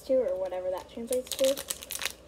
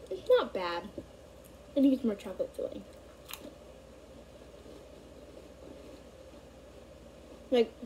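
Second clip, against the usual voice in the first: chewing while talking; speaking around food; mouth full; 7 dB quieter, faster than usual. Real, not acted. Seen 1: Chews cookie while speaking, bites it again around 1.1-1.6 s.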